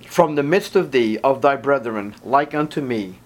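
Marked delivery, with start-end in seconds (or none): none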